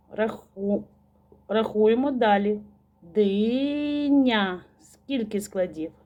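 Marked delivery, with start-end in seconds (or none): 3.0-4.6 "Диня" as a long "ди", then "ня"; slow chanted "Диня"; syllable by syllable. Shown as a demonstration. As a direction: stretch "Диня" into two beats. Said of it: "промовляйте слова разом зі мною".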